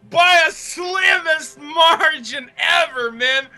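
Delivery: loud, slow, elated yelling; excited shouting. Real, not acted. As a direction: still shouting after the win. Still shouting after the win.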